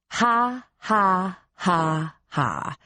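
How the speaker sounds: spaced-out, descending fake laugh; dry, sarcastic "ha ha"; mock laugh. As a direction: step each ha down, unamused. Her scornful silent reaction.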